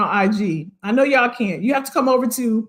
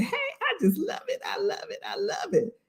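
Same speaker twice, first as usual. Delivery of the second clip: gushing fan; 9 semitones higher, 11 dB quieter than usual. Retold or imitated. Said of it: "everybody just saying".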